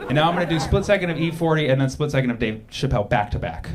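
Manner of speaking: ordinary talk, nothing marked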